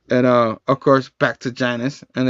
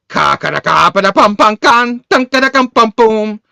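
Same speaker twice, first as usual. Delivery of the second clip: loud rapid-fire mouth sound effects; imitated fight noises; 8 semitones higher, 6 dB louder than usual. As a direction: percussive syllables climbing high and loud.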